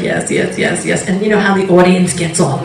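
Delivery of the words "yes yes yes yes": softer, breathy, obedient yeses; mousy husband going along with it; henpecked husband softly agreeing; meek husband. Retold or imitated. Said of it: "he was like".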